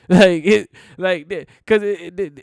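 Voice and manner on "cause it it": ordinary delivery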